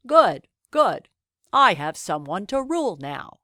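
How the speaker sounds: lightly voiced pleased king; a hint of a glad old king; happy king. A narrator's reading. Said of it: "he happily said".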